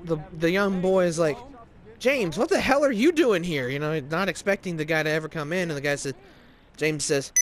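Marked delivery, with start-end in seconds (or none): 2.0-3.7 higher-pitched startled question; surprised. Retold or imitated. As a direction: retell it as an astonished blurt. Boy startled by James's arrival.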